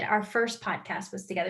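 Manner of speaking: normal talking voice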